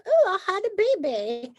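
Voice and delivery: high, gushing celebrity parody; mock celebrity. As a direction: play a celebrity cooing over big news. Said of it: "they're like".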